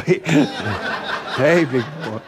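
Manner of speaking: low coo broken by a laugh; giggling mock come-on; sultry tease. Pastor joking about wife Rosanna, mock sultry, laughing. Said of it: "her saying".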